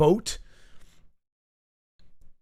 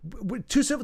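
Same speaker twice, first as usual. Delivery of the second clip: ordinary delivery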